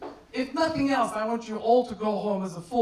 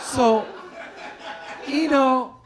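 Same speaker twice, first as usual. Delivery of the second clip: calling out the name Ino loudly; 4 semitones higher, 6 dB louder, much slower than usual. Real, not acted. Comic tells the crowd his name, loud. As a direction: bellow it across the room. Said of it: "bring it down a notch".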